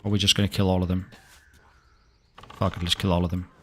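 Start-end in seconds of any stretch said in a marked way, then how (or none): none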